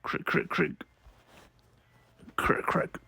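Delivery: very soft, voiceless crackle; hushed sound effect. Acted. Reader sounds out a comic's creaking effect, hushed. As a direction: barely voice the little cracking noise.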